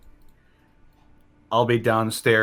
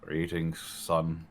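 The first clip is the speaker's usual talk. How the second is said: deep fatherly voice; 5 semitones lower, 9 dB quieter, much slower than usual. Acted. Character greets his son.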